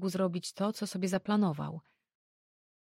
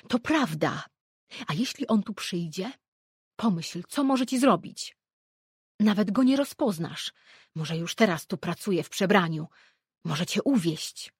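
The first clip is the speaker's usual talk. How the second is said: louder, rising alarm; frantic warning; 7 dB louder than usual. A narrator's reading. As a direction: pile on the fears, getting louder. Mother frantically warns her daughter.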